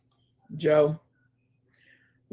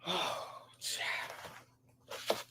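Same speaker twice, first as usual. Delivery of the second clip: breathless whisper; 14 dB quieter than usual. Real, not acted. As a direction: sigh it out, overheated. Breathless aside before fanning.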